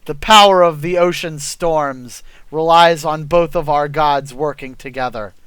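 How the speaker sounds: usual speaking voice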